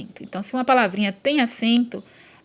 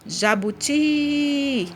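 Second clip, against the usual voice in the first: JABUTI called out with the final TI held long and higher; calling out the word; 5 semitones higher, much slower than usual. Shown as a demonstration. Teacher calls the word like a person's name, drawn-out calling. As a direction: stretch the TI of JABUTI as if calling someone. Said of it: "como se estivesse chamando uma pessoa".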